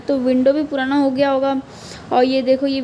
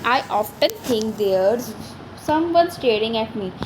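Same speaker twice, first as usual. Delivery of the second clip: put-on very slow, each word laid out for children; slow poem recital; 2 semitones lower, much slower than usual. Teacher rereading the child's line slowly to her class. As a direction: read the verse slowly, word by word.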